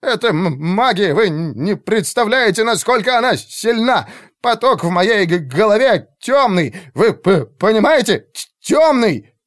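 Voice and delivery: high-pitched, panicked stammer; frantic stuttering. A narrator's reading. Mage warns commander of dark magic, stammering frantically. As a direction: trip over first consonants in rising panic. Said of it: "заикался больше обычного".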